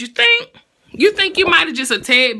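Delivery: high, loud mocking You think; sarcastic retort. Real, not acted. Vlogger mocking the singer's apology, sarcastic. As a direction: fire it back full of sarcasm.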